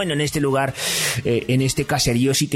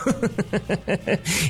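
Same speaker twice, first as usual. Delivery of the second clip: laughter; 8 semitones higher, 4 dB quieter than usual. Real, not acted. Laugh after joke.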